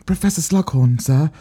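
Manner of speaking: a hint of prim English schoolgirl; lightly voiced English pupil asking sir; posh English accent. To Professor Slughorn, in a light English schoolgirl accent. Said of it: "most accurate English accent".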